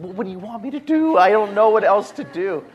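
exasperated retort. Retold or imitated. Husband retorts to wife.

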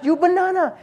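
put-on very high Chinese-accented lady; high mock Chinese accent, firm. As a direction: imitate a Chinese lady insisting. Imitates Chinese lady repeating herself.

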